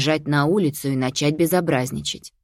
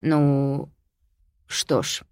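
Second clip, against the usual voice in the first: flustered; 2 semitones lower, much slower than usual. A narrator's reading. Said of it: "растерялся отец".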